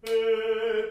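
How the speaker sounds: えー launched loud from the diaphragm, held steady; supported, struck vowel. Shown as a demonstration. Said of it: "えーっと音が出るはず".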